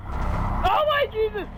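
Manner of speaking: high, slow panicked cry; terrified scream. Real, not acted. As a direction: shriek in real fright. Jump scare in game.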